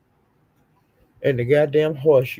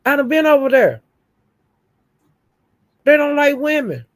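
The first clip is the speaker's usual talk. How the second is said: heated yelling; 10 semitones higher, 5 dB louder than usual. Real, not acted. Ranting about the Manosphere.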